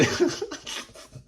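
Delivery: wheezy high giggle; high breathy laugh. Real, not acted. Laughing at story.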